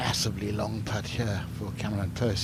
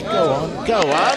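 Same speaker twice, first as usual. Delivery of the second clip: high, loud cry urging the ball on; excited urging; 6 semitones higher, 9 dB louder, slower than usual. Real, not acted. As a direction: shout it in, willing the putt to drop. Commentator urging ball toward hole.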